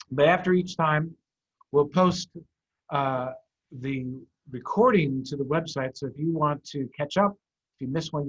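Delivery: ordinary delivery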